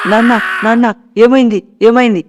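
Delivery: high, loud cry of alarm; alarmed shout. Retold or imitated. Son calling to his father on the roof, alarmed shout. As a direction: shout up the stairs in panic. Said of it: "అని నేను అరుస్తూ".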